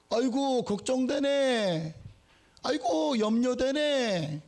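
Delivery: slow, drawn-out sighing mock concern; mock sympathy. Preacher mocking empty words of concern, slow and sighing. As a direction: overplay hollow pity.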